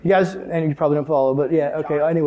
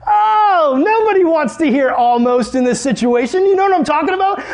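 much higher, loud, worked up; exasperated; 10 semitones higher, 3 dB louder than usual. Real, not acted. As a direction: groan at that dreaded word. Exasperated aside to audience.

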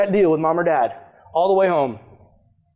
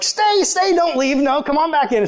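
higher, pleading them to stay; eager mock pleading; 7 semitones higher than usual. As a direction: call them back eagerly, half joking. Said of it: "I'm like".

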